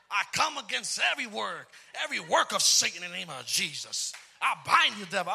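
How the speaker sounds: loud, fiery spiritual warfare prayer; gospel rebuke. Retold or imitated. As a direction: bind the devil at full volume. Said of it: "Real gospel".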